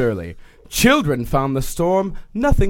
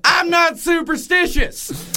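shouting; 12 semitones higher than usual. Retold or imitated. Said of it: "Spencer shouted".

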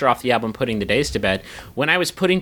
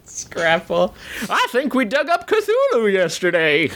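put-on laughing old-timer voice; old prospector voice; 7 semitones higher, slower than usual. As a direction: cackle through a miner's tale. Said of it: "Use your old prospector voice".

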